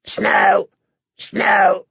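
high squawking raven call; raven cry. Acted. Ravens crying at Sam in the rookery, squawked. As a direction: caw it like a raven. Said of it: "they cried at him".

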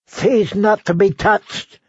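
slow, high lisping screech; high-pitched, spluttering outrage; screaming, lisping goat. A narrator's reading. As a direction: shriek the order with a slobbering lisp. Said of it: "the goat screamed".

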